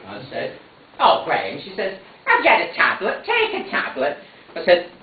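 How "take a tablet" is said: fussy mother. Acted. Mother fussing at son.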